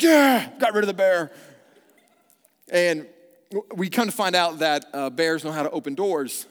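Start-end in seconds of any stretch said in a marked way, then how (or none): 0.0-1.3 loud, high mock triumph; husband boasting after bear leaves, loud and triumphant. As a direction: crow it like a hero. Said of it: "and I'm like".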